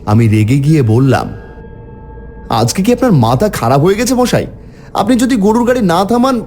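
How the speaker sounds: ordinary delivery, nothing marked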